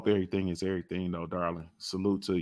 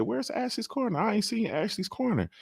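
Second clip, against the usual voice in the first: much higher, imitating a woman; woman's voice; 14 semitones higher, 5 dB quieter than usual. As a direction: mimic her complaining. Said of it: "It was a woman, too".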